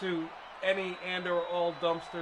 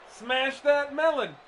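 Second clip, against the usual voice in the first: loud, higher, drawn-out mock yell; imitated scream; 6 semitones higher, 6 dB louder, slower than usual. Quoting the comedian rushing the stage with his mallet, mock scream. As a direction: belt it out like a stage comic's catchphrase. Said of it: "and he screams".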